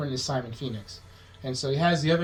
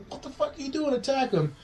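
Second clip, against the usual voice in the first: mock goading; 11 semitones higher than usual. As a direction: imitate an exasperated boss ordering an attack. Retold villain egging on henchman.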